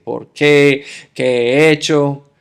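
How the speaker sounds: put-on a girl's upset shout, only hinted at; retold crying shout. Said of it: "Empezó a llorar y gritar y decir". (grito lloroso imitado, más fuerte)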